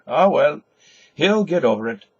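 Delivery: loud, high and breezy; a jovial carter shrugging it off; hearty dismissal. Acted. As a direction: wave it away cheerfully. Corny brushes it off.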